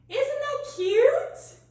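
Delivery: high, slow gushing question. Real, not acted. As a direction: gush over how cute it is. Teacher showing sweetheart move.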